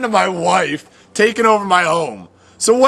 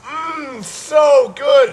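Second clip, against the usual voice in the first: excited; 7 semitones higher, much slower than usual. Acted. Gorging on free food.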